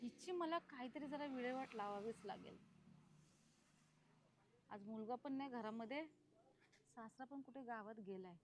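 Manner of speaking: very soft, low plotting; hushed, vengeful soliloquy; scheming to oneself. Acted. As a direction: think aloud under your breath, menacingly. Plots alone against daughter-in-law.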